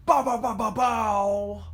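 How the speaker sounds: loud, high "bow" riff; mock music riff. Mover jokes about spicing up her life, loud mock riff. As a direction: belt out a vocal riff.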